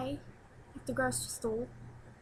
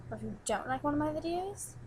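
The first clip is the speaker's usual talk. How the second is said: ordinary delivery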